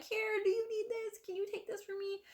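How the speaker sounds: very high, small pleading voice; acted anxious asking. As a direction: imitate yourself asking helpfully in a squeaky-high voice.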